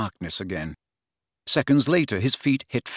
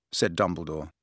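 ordinary delivery, nothing marked

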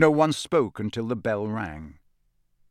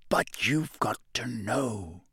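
slow, breathless whisper; old professor insisting quietly to Harry, whispered; 4 dB quieter, slower than usual. A narrator's reading. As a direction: lean in and whisper it. Moody explaining to Harry.

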